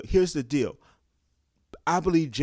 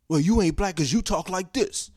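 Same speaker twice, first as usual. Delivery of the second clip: a pitched-up put-on voice for the accuser; a snide caricature of a gatekeeper, voiced higher; mocking accuser, higher voice; 6 semitones higher than usual. Gatekeeper mocking someone's speech, in a higher put-on voice. Mock accuser in community quarrel.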